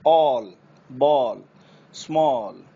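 वाटर, टॉक with a long rounded aw; open aw words. Shown as a demonstration. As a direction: round each aw fully. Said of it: "औ की निकलेगी". (औ वाले शब्द)

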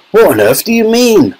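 high, sharp demand. A narrator's reading. Father questions Wonka's worrying remark, sharp demand. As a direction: demand an explanation, pitch jumping up. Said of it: "asked Mister Teavee sharply".

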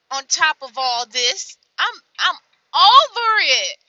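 building to a loud, high, slow "over it"; fed-up outburst. Real, not acted. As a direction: let the frustration boil over. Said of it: "I'm mad. I'm irritated".

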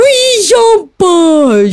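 high, drawn-out squeal of delight; gleeful squeal. Real, not acted. Gamer cheering as her rival falls, drawn-out squeal.

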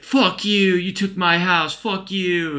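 high, strained angry yell, imitated; mock drunken rage. Evicted dad cursing the family who moved in, mock angry yell.

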